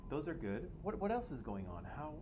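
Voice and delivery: ordinary delivery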